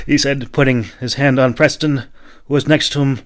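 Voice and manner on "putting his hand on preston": usual speaking voice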